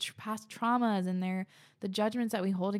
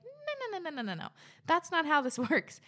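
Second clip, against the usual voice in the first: high, rattled-off string of no's; emphatic refusal; 9 semitones higher, 3 dB louder than usual. Real, not acted. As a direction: pitch leaps up, then tumbles down in protest. Playfully rejecting manifestation advice.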